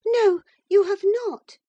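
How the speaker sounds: put-on high, loud, defiant girl; infatuated girl. A narrator's reading. Infatuated girl contradicting her sister-in-law, defiant. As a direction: contradict at the top of your range. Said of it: "said the infatuated girl".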